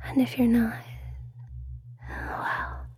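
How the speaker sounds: intimate ASMR whisper; breathy hypnotic whisper close to the mic. Acted.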